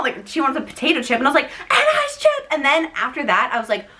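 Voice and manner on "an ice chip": very high, strained demand; mock scream. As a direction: imitate a desperate shriek without full volume. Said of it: "I just screamed so loud".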